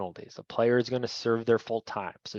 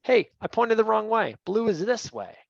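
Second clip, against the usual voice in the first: called out higher and louder to the field; announcing the correction; 8 semitones higher, 3 dB louder than usual. Shown as a demonstration. Referee informing all players of the pointing error, called out. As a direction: own the error and tell everybody clearly. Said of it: "Inform everybody".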